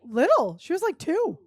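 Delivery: loud, high-pitched disbelief; real amazed shout; amazed outburst. Real, not acted. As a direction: exclaim it in astonishment, voice leaping up. Exclaims at how young she was.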